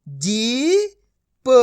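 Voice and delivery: put-on जीप read slowly, sounded out for children, x0.7; slow blending demo. Shown as a demonstration. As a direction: draw out जीप slowly so the sound of each letter comes through. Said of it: "अब आपको मेरा तरीका देखना है".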